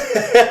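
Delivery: laughing. Real, not acted. Laughing at the start.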